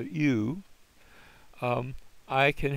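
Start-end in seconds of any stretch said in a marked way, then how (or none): 0.0-0.6 "U." said as one glide, both sounds squished together; teacher demonstrating the U diphthong. Shown as a demonstration. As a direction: model the "U" sound clearly. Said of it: "I get both sounds, but they're squished together".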